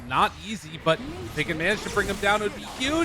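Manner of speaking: 3 hype builds as the fight unfolds; 1 building excitement. Real, not acted. 4 caster calling the gank on Whisper, excitement building fast.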